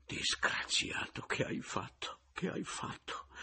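unvoiced, slow whispered groan; hushed, aghast whisper; horrified moan. A narrator's reading. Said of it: "e gemette".

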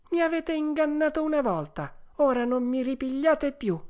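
high, firm puppet voice; scornful puppet. Acted. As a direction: rebuff the swindlers sharply. Puppet rebuffs former swindlers. (burattino sprezzante, voce acuta e decisa)

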